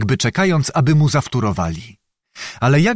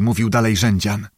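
ordinary delivery

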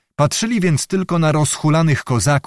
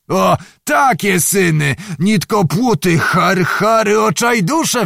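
high, drawn-out scolding shout; sudden exclamation; 7 semitones higher, slower than usual. A narrator's reading. Zagłoba berating the drinking Cossacks, sudden exclamation. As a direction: burst out cursing, high and slow. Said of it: "wykrzyknął nagle".

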